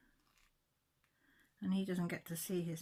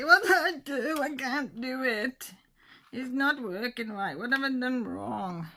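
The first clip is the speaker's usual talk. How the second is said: high, loud, whiny mock panic; hammed-up helpless wail to her daughter; mock panicked pleading; 7 semitones higher, 9 dB louder than usual. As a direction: pretend flustered cry for help. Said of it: "bother trees later and say".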